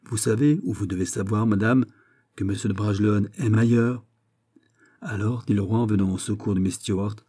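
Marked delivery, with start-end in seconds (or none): none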